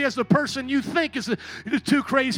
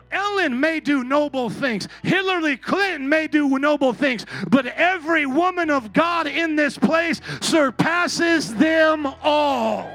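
loud, driving preacher's shout; preaching at full force; 6 semitones higher, 7 dB louder than usual. Acted. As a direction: preach it hard to the congregation.

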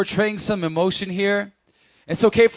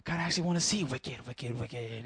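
quiet hushed wish; 8 semitones lower, 14 dB quieter than usual. Retold or imitated. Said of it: "I'm just like".

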